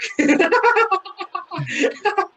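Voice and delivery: shrill squealing laugh; helpless high giggle; high-pitched laugh. Real, not acted. Laughing at wrestler's reaction.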